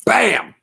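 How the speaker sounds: sharp shouted gunshot bang; loud mouth-made shotgun bang; gunshot noise. Retold or imitated. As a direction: bark it out like a shotgun blast. Shotgun blast imitated.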